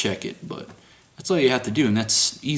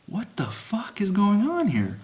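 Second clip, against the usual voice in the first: higher, mock-bewildered inner thought; baffled; 11 semitones higher than usual. Guard recalling his shock at his coworker's praise, mock-bewildered. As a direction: play it like you can't believe what's happening.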